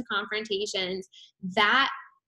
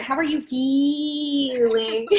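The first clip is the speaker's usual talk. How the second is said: very slow, drawn-out, higher slogan read; slogan delivery; 5 semitones higher, much slower than usual. Pretend. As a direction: stretch it out like printing it on a shirt. Slogan for a t-shirt.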